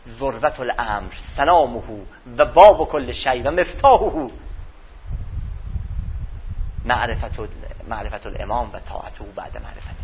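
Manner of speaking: measured devotional reading of Arabic; hadith recitation. Acted.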